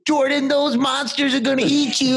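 hammed-up worried mom pleading with her son; mock scared mom. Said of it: "my mom being like".

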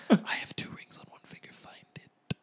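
hushed unvoiced whisper. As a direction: whisper it conspiratorially. Whispered joke.

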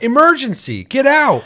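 loud, high robotic alarm announcement; mock emergency alarm voice. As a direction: blare it like a smoke detector's recorded voice. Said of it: "And then it's like".

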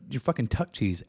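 usual speaking voice

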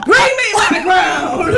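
slow, booming queen's command; mock royal decree. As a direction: proclaim it like a haughty queen.

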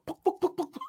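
high little popping noises; playful toy-gun pops; imitated Nerf gun shots. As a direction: mimic foam darts firing. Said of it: "with the Nerf ball".

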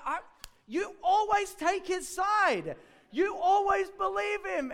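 high, loud, slow sibling complaint; whiny yell. Retold or imitated. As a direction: yell it like a wronged kid brother. Younger brother complains to mother.

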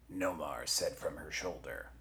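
usual speaking voice

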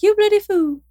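very high British duck impression; British-sounding duck. Shown as a demonstration. Host shows the duck's accent to co-host, high British duck voice. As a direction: mimic a talking duck high up, British-style. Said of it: "it actually sounds more British than Australian".

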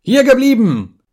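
very high, loud yell; all-out furious shout; roared command. A narrator's reading. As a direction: roar it to stop someone leaving. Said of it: "brüllte ich".

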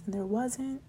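ordinary delivery

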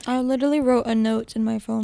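usual speaking voice

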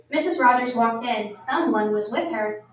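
ordinary delivery, nothing marked

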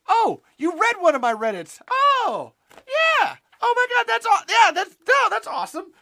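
high, loud, over-the-top fan squeal; mock gushing excitement. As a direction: gush loudly, pitch shooting way up. Acting out his excited comment reactions.

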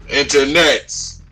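loud, slow announcer call; drawn-out call. Acted. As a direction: boom it out slowly. Show closing call.

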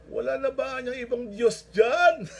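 high, very loud, stretched-out call; comic desperate shout. Retold or imitated. Hanging man asks heaven for another God, hollering slowly. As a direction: holler the punchline up to the sky.

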